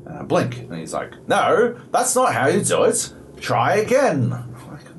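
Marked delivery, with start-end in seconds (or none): 1.3-4.3 high-pitched mock British accent; super British robot. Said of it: "I don't know why he's super British".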